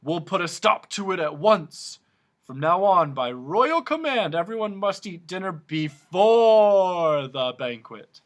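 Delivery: booming king decree; royal decree. A narrator's reading. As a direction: decree it for all to hear. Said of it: "shouted the king".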